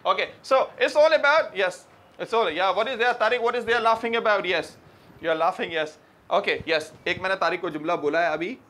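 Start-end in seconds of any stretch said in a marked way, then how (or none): none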